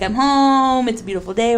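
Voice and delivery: dad's greeting. Retold or imitated. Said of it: "he would say that, be like".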